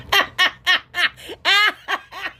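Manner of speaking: long, high peal of laughter; helpless laugh. Real, not acted. Crafter cracking up at showing it so many times, helpless laugh. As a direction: burst into real high giggles. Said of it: "I can't stop laughing".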